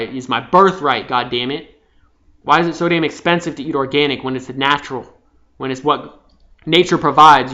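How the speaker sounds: loud, bitter ranting inner voice; acted rage. Venting rage at the system, angry loud rant. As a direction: rant at the world like it owes you. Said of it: "you got hatred and rage".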